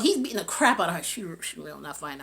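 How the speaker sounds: ordinary talk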